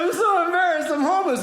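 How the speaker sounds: high, hammed-up whine of shame; mock tearful plea. Comic copying the homeless friend's confession, mock tearful plea. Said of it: "The guy starts crying".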